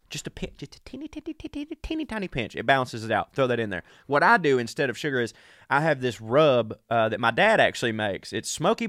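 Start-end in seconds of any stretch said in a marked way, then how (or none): 0.1-2.6 very high, soft, squeaky; tiny squeaky voice. Acted.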